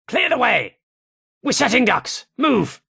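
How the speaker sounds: high, urgent barked orders; urgent yell. Acted. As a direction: clear the way under fire. Soldier yells orders under fire.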